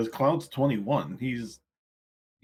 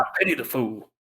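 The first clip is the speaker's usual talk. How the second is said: lightly voiced Mister T catchphrase; 2 semitones higher than usual. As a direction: quote it with a hint of impersonation. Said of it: "Mister T".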